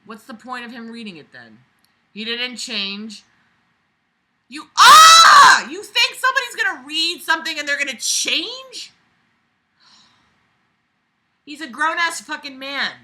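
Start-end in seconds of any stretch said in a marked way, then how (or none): 4.5-5.7 very high, very loud shriek; furious scream. Real, not acted. Reader screaming at the writer in fury. 5.7-12.9 higher, heated scolding; angry rant. Real, not acted. Reader ranting at the writer, angry.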